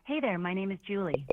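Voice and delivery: ordinary delivery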